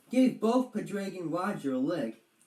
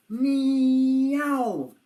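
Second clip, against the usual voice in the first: kitten meow; 7 semitones higher, 8 dB louder than usual. Acted. Kitten cries from tree.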